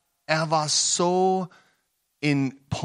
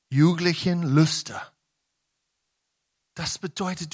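ordinary talk, nothing marked

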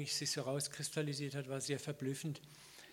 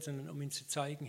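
ordinary talk, nothing marked

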